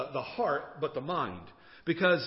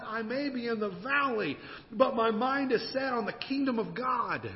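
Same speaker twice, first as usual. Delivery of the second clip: higher, ringing declaration of faith; preaching; 7 semitones higher, 4 dB louder than usual. Real, not acted. Preacher declaring God is with him, impassioned and emphatic. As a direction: proclaim it in a driving cadence.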